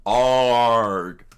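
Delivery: loud strangled pained cry. Acted. Zolo cries out while his neck is crushed, loud pained grunt. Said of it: "Zolo screamed out".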